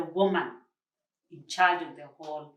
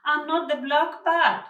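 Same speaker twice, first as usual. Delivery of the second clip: firm protest; 9 semitones higher, 8 dB louder than usual. Acted. Arrested woman protests innocence.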